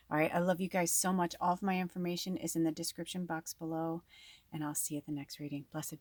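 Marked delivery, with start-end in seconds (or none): none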